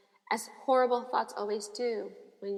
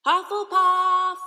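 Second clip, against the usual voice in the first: slow, high, loud booming hat; hat shouting; 8 semitones higher, 11 dB louder, much slower than usual. A narrator's reading. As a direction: bellow the house like a magic hat. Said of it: "shouted the hat again".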